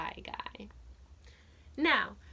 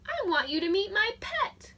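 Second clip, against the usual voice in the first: put-on eager boy; 7 semitones higher, 4 dB louder than usual. Boy Buzz introduces pet.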